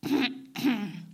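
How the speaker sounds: acted throat-clearing before a recital; performer's preparatory ahem. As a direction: clear your throat for an announcement. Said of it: "Der Frederik räuspert sich".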